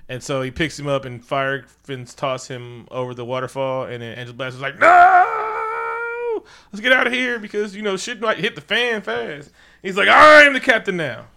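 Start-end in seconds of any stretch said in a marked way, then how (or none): 4.8-9.5 high, loud frantic cry of no; mock panicked shout to flee; panicked yell. Panicked character retreat line. 10.1-11.3 high, loud mock captain line; loud mock proclamation of taking over; hammed-up proclamation. Movie quote parody.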